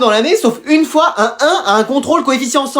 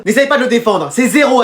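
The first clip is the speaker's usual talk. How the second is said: ordinary talk, nothing marked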